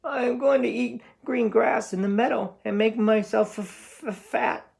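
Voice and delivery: very high, stammering goat voice; scared goat. Acted. Middle goat answering the troll, high and stammering. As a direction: stutter the last word in fear.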